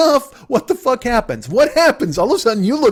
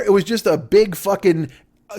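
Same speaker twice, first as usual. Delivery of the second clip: ordinary delivery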